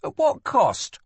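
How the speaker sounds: demanding. A narrator's reading. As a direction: press hard, pitch jumping up. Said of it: "demanded the prince".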